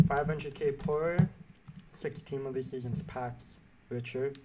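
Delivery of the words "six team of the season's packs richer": ordinary talk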